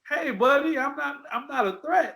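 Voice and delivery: put-on high nice-guy voice telling older shoppers he's no threat; high placating voice. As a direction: act harmless in a high, careful voice. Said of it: "it was just like".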